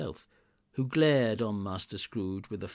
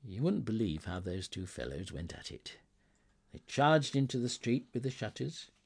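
ordinary delivery, nothing marked

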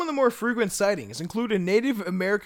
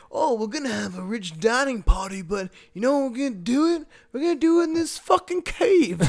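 mock rich partygoer; 4 semitones higher, 4 dB louder than usual. Mocking rich cave partygoers.

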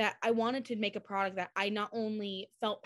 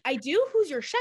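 a lightly voiced excited Ashley, high and louder; imitating Ashley; 6 semitones higher, 7 dB louder than usual. Ashley asking about the chef, mock eager. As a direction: play the curious star, up high. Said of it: "And she goes".

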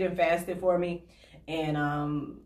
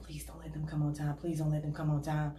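whispered prayer; 4 semitones lower, 10 dB quieter than usual. Retold or imitated. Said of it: "I'd be like".

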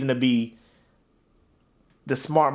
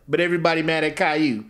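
usual speaking voice

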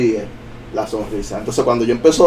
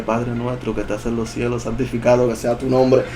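much softer recited prayer; praying; 7 dB quieter than usual. Retold or imitated. Dreamer prays against the demon, reciting softly. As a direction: murmur the prayer under the story. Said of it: "empecé a orarle".

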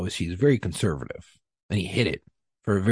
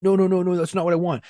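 mock flustered boss; 9 semitones higher than usual. Supervisors backpedal in retold argument.

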